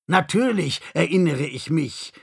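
insulted patient flares up at his nurse, angry; angry outburst. A narrator's reading. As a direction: bristle and snap back. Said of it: "begehrte er wütend auf".

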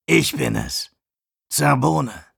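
tiefe, fremdartige Schlangenstimme; zischelnde Wesenstimme. Acted. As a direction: fremd und zischelnd beruhigen. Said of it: "in zischelndem Interkosmo". (Schlangenwesen, tief und zischelnd)

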